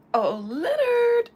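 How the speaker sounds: high, loud, drawn-out wail of Oh, Leonard; calling out long. Pretend. Psychic calling out to Leonard Larry, long high loud wail. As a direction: sing out the name like a grandma calling across the yard.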